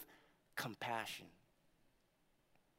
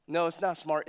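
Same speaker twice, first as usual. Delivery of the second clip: ordinary delivery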